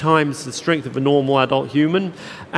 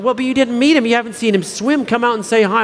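higher, eager coaxing voice; pushy director; 6 semitones higher than usual. Retold or imitated. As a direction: nudge him out, lightly chirpy. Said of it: "and she's like".

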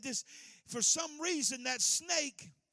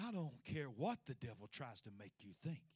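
ordinary talk, nothing marked

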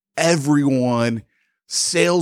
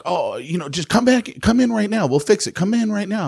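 placating salesman; 9 semitones higher, faster than usual. Retold or imitated.

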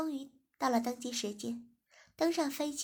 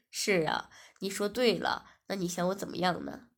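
lower, steady man's voice for 文轩; male lead's voice; 4 semitones lower, 5 dB louder than usual. Acted. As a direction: speak as the calm young boss. Narrator voices male lead. (低沉的男主角声音)

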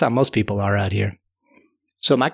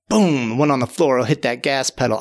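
plain reading voice

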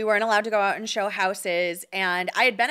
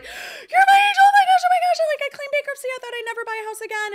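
much higher and louder, imitating overjoyed tears; crying with joy; 8 dB louder, much faster than usual. A first client told she can buy a house, high, loud tearful joy. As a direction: burst out like a sobbing, thrilled first-time buyer. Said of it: "she, like, cried".

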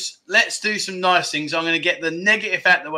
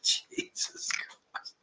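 whisper; 15 dB quieter, much slower than usual. Real, not acted. Exasperated aside after viewer joke.

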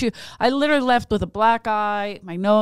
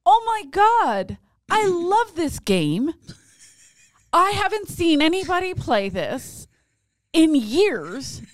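much higher, slow, delighted voice of a passing man; excited stranger; 7 semitones higher, slower than usual. Retold or imitated. Man at the field house excited to see the game played. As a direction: gush like a stranger thrilled to find his old game.

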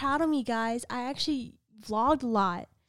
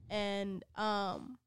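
usual speaking voice

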